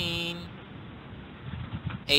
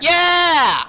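high, loud yeah; excited shout; cheering; 9 semitones higher, 6 dB louder than usual. Real, not acted. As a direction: whoop it out in triumph. Cheering in game.

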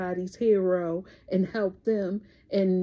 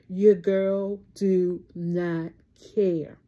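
slow emphatic catchphrase; 3 dB louder, much slower than usual. Real, not acted. Vlogger to her sunshines, slow firm refusal. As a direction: do not stretched out, then a falling care.

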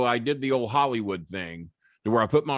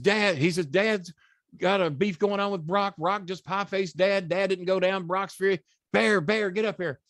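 higher, hurried alarm; urgent call for help; 8 semitones higher than usual. Retold or imitated. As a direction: sound the alarm, breathless. Chris calling urgently for help.

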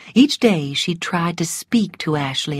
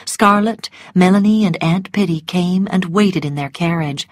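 usual speaking voice

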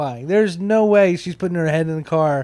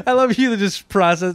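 laughing; 7 semitones higher than usual. Real, not acted. Laughing at friend's pause.

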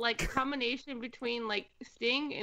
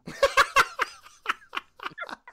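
high burst of laughter; 13 semitones higher, 6 dB louder than usual. Real, not acted. Laughs at Nickelback joke.

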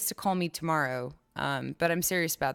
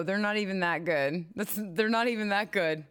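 ordinary talk, nothing marked

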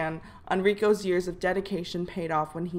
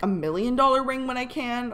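normal talking voice

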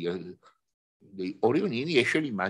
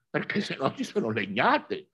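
ordinary delivery

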